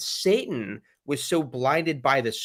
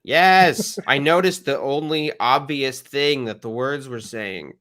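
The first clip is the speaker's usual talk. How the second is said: exasperated yes; 5 dB louder than usual. Acted. Host retorts to the recorded speaker, exasperated. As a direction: answer a painfully obvious question. Mock answer to speaker's question.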